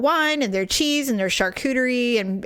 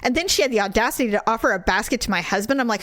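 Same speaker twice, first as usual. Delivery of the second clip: ordinary delivery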